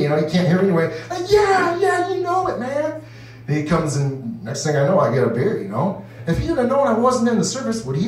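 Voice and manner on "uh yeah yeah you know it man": high, breezy bragging reply; hammed-up veteran swagger; cocky mock veteran. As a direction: play along like a proud vet. His fake veteran reply.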